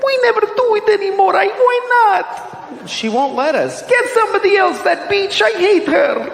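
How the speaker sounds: high squeaky whining little voice; whiny penis voice. Pretend. Comic voicing his neglected penis, high squeaky whine. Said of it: "My penis was crying".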